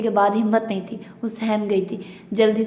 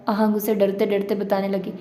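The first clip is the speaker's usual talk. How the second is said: usual speaking voice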